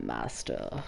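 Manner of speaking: very low, soft master; trailing murmur. Acted. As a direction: let it fall away.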